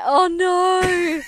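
loud mock dismay. Mates reacting in dismay.

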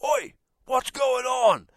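high, slow, puffed-up shout; tough guy shout. Retold or imitated. Husband yelling at a suspected burglar, high slow puffed-up shout. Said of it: "tried to act way bigger than I am".

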